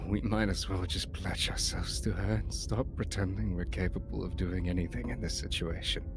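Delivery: gloomy hero voice. Acted. Hero voice, low and pessimistic.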